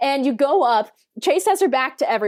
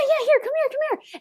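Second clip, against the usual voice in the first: imitating an eager host, much higher; mock-gushing "come on up", pitched way up; eager welcome; 12 semitones higher, faster than usual. Organizers waving her on stage.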